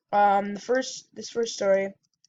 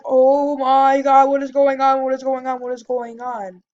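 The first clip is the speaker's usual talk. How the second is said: panicked; 4 semitones higher, 8 dB louder than usual. Retold or imitated. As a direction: start in loud alarm, then let it die down. Panicking during the clown chase.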